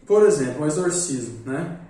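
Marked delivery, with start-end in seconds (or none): none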